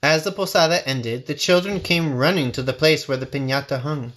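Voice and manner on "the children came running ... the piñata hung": ordinary delivery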